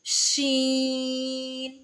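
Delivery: शीन held long with spread hush; long spread sheen. Shown as a demonstration. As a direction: draw शीन out, spreading the sound. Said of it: "इसमें आवाज़ को फैलाएंगे". (शीन फैलाकर लंबा)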